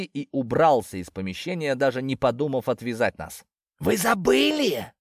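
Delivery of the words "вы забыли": high, drawn-out shout after the guards; shout after them. A narrator's reading. Snake shouts after the departing guards, high and drawn out. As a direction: call out to the leaving guards, slow and high. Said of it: "крикнул вслед уходящей охране змей".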